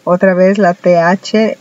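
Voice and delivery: ordinary talk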